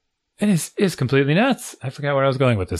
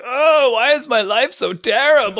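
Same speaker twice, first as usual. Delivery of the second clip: higher mock whine of a sulking son; whiny kid; 8 semitones higher than usual. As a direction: ham up a self-pitying kid. Son retold whining about punishment.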